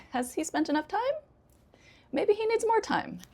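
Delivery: innocent question. Shown as a demonstration. Teacher acting out the genuine meaning, innocent. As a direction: wonder innocently, pitch lifted high. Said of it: "Innocent question".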